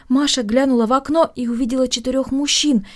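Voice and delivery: ordinary delivery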